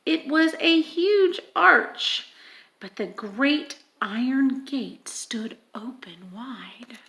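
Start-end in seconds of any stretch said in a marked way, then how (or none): 2.8-7.0 softer hushed whisper. A narrator's reading. Reader whispering as Edmund finds the gate. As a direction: breathe it, suspense.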